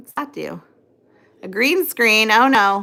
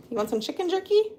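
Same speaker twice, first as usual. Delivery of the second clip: much higher sing-song dog talk; high baby talk; 10 semitones higher, 5 dB quieter than usual. Acted. Owner coaxes her German Shepherd with a treat, high sing-song baby talk.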